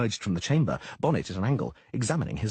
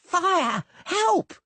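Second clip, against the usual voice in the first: high, loud alarm yell; 6 dB louder than usual. Acted. Doppler yelling as he rushes out. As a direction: yell the alarm at full pitch. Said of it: "it yelled".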